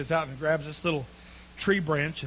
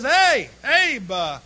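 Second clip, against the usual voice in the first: friendly neighbor; 7 semitones higher, 8 dB louder, slower than usual. Acted. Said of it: "neighbor comes walking ... and says".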